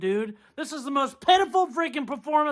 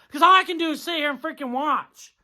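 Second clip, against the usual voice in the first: ordinary talk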